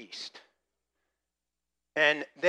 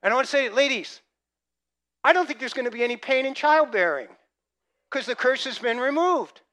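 loud, high, fired-up pulpit voice; excited sermon delivery; preaching at full force; 10 semitones higher, 16 dB louder than usual. Acted. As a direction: preach it to the back row.

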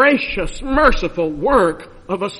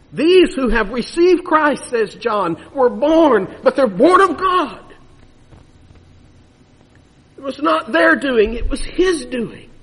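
emphatic preaching; 5 semitones higher, 4 dB louder than usual. Real, not acted. Building emphatic climax on God's birth.

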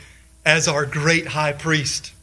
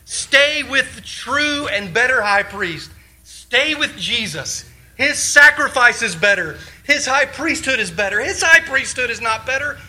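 high, impassioned exhortation; fervent pulpit preaching, higher pitched; preaching at full force; 5 semitones higher than usual. Acted. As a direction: plead with the congregation to stay.

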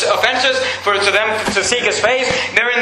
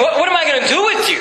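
quick, higher-pitched exasperation; exasperated parent; 6 semitones higher, faster than usual. Acted. Preacher as parent at wits' end with children, exasperated. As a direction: throw it out like a fed-up parent. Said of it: "you ever said that to your children".

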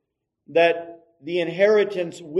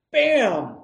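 a sudden shouted impact noise, higher; loud bam; 6 semitones higher, 6 dB louder than usual. Retold or imitated. Telling the congregation about the wrestling crash, a shouted bam. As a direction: boom it out like heads colliding. Preacher's retold wrestling collision.

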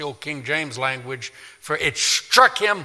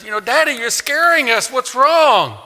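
put-on much higher, louder scared child; frightened child; 10 semitones higher, 6 dB louder than usual. Child asking frightened father, high scared voice. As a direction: plead like a scared little kid.

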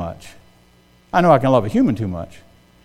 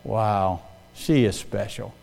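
soft, slow, lower awed aside; hushed wonder; 8 semitones lower, 11 dB quieter, much slower than usual. Retold or imitated. Husband voicing his own quiet awe at wife. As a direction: breathe it out in admiration. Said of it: "I'm like".

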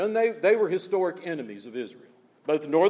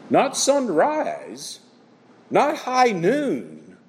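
slow emphatic; 2 semitones higher, 5 dB louder, much slower than usual. Real, not acted. Preacher drives home contrast.

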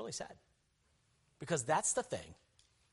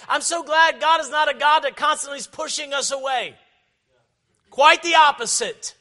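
loud, high, fervent sermon delivery; raised pulpit voice, driving hard; full-force preaching; 12 semitones higher, 16 dB louder than usual. Acted. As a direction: preach it out loud to the whole congregation.

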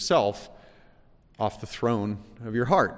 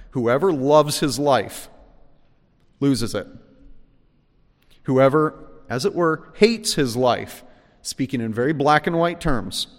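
emphatic preaching; 3 semitones higher, 5 dB louder than usual. Acted. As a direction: drive each clause home. Preaching Jesus' words.

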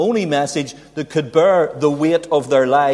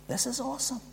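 higher and much softer, reverent; hushed awe; 11 semitones higher, 12 dB quieter, faster than usual. Real, not acted. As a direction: breathe it out in wonder. Hushed awe after plain 'good'.

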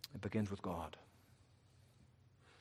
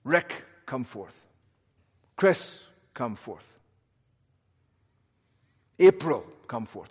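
summoning by name; 3 dB louder than usual. Pretend. God calling each believer by name, commanding call. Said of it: "there's a specific call, like".

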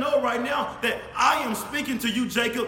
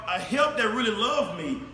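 ordinary talk

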